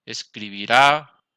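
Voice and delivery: "escribirá" slowly, landing loud on "rá"; slow "escribirá" with a loud final syllable; stress on last syllable. Shown as a demonstration. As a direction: stretch and punch the "rá" of "escribirá". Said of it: "tiene el acento en la última sílaba".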